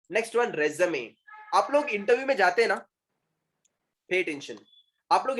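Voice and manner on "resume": resume as three syllables, "re-zuh-may"; correct résumé. Shown as a demonstration. Teacher introducing the next word, resume as "re-zuh-may". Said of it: "Resume, it is not resume".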